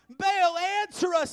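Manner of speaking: loud, high pleading cry; shouted. A narrator's reading. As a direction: yell it up at an empty sky. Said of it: "They shouted".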